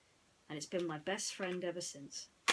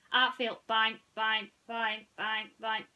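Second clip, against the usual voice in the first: higher, loud stuttering electronic toy; stuck robot voice; 3 semitones higher, 7 dB louder, slower than usual. Acted. Mister Spell glitches while spelling, robotic stutter. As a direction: repeat like a glitching machine.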